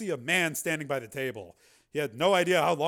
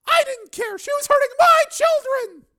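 high, loud, fierce woman's cry; a mother's fury; defiant shout; 13 dB louder than usual. Acted. Donna snapping back at Steele, fierce shout.

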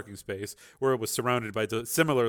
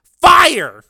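very loud, high yell; yelled command; 18 dB louder than usual. A narrator's reading. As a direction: scream the order. Voice commands to weapon computer.

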